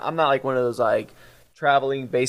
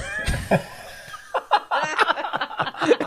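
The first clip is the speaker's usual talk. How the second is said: high, burst-out laughter at the punchline; long real laugh. Real, not acted. Host laughing at his own canyon joke, real laughter. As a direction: crack up at the punchline.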